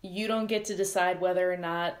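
ordinary talk

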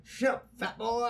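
high, drawn-out wrestler's heckle; Ric Flair. Retold or imitated. Co-host acts out a walking heckler, high wrestler impression. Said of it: "Kinda sounded like Ric Flair".

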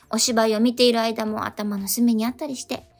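ordinary talk, nothing marked

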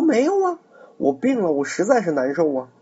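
high, whining voice faking illness; feigned sick whine. Acted. Dong Cheng pretending to be ill to send Ma Teng away, high whiny voice. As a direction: pitch way up like a sick man moaning.

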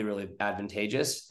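ordinary delivery

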